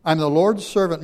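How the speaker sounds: Mary declaring herself, loud and full; proclaimed loud. Acted. Preacher voicing Mary's vow to the angel, loud and full. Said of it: "Mary answered".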